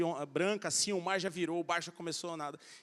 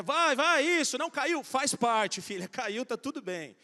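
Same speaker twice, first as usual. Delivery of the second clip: cheering on; 6 semitones higher, 7 dB louder, slower than usual. Retold or imitated. Father cheering daughter riding away.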